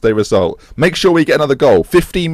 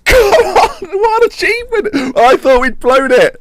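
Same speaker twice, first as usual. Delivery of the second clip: high, laughing, near-crying joy; overwhelmed joy at the title; laughing and tearful; 4 dB louder, slower than usual. Real, not acted. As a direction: laugh through happy tears. Celebrating the league title.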